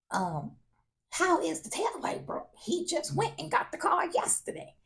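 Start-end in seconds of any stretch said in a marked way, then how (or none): 1.1-4.8 high, incredulous friend voice; indignant friend. Retold or imitated. Best friend questioning the cop, indignant. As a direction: protest the broken light. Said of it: "he like".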